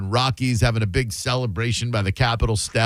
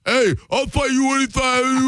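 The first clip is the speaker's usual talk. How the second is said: Rocky explodes; 11 semitones higher than usual. Retold or imitated. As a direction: blow up and challenge him. Rocky snaps back.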